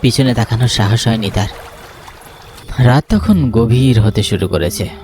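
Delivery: usual speaking voice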